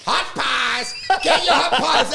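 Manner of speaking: high, loud imitated pie seller; yelling vendor. Pieman bursting into the show, hammed-up vendor yell. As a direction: holler it like hawking pies. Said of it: "And he started yelling".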